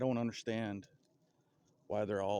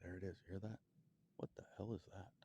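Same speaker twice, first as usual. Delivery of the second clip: hushed alarmed whisper; 10 dB quieter, faster than usual. Real, not acted. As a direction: whisper so the animal won't hear. Hears a strange noise in forest.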